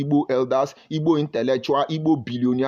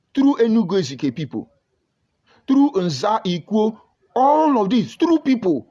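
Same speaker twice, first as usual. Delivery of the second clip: rising fervor; 7 semitones higher, 4 dB louder than usual. Real, not acted. Building list to climax.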